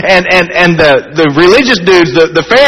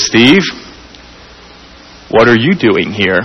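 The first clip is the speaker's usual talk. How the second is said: voice from the throne; 7 semitones lower than usual. Retold or imitated. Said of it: "the voice from the throne".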